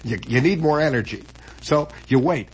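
ordinary delivery

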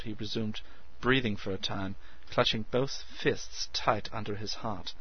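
plain reading voice

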